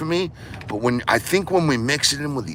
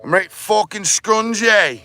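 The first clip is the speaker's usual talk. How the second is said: hammed-up Aussie bloke voice, pitched higher; mock Aussie accent; 4 semitones higher than usual. Vlogger clowning for viewers in a mock Aussie accent. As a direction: play a rowdy Aussie larrikin.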